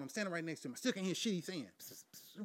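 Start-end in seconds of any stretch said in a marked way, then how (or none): 1.8-2.4 a hissed psst again and again, barely there; imitating a whispering friend. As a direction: hiss to get his attention without waking her. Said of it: "He's still whispering".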